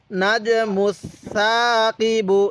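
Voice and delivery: lisped soft "th" in "মুসাকিবু", vowel drawn out; slow "মুসাকিবু" as "muth-thaa-qibu"; soft tha. Shown as a demonstration. Teacher showing how to learn it, soft "th" in slow "মুসাকিবু". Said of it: "এরকমভাবে শিখব".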